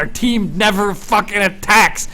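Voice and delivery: loud, high-pitched angry gamer rant; furious, shouted frustration; exasperated rant. Real, not acted. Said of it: "I'm getting so mad at this".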